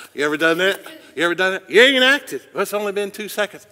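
loud, high, playful challenge; animated teasing. Real, not acted. Preacher joking with congregation about waiting on God, loud animated teasing. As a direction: call them out, voice leaping up.

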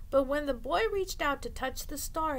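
plain reading voice

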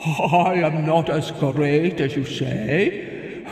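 modest cloud. Acted. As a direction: wave it off kindly. Cloud declines, advises.

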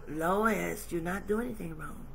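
a touch of tender divine voice; God's voice. Acted. God reassures Lois.

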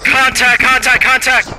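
high, urgent battle call; shouting contact. Real, not acted. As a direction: yell it out to the squad. Calling out enemy contact.

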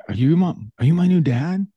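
lightly voiced eager little boy; hopeful little boy. Little boy asking a new man, hopeful. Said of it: "I just think of this little boy".

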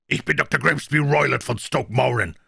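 high, forceful blustering self-introduction; aggressive announcement. A narrator's reading. Furious doctor introducing himself to Holmes, aggressive and high. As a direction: announce yourself as a threat, pitched high.

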